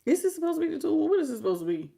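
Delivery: fast, disbelieving question; confused reaction to the card; puzzled. Real, not acted. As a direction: squint at the card, baffled. Puzzled at odd card art.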